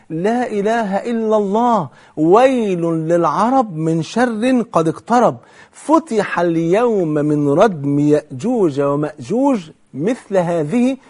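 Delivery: very slow, drawn-out recital; measured, ringing proclamation of impending danger; solemn alarmed warning. Retold or imitated. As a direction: repeat the warning gravely, stretching every word. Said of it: "أعيدها تاني. النبي بيقول إيه؟".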